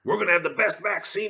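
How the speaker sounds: high, loud boastful Trump voice; Trump impression. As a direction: brag it like a showman. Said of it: "Trump over there with the vaccine".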